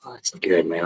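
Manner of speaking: usual speaking voice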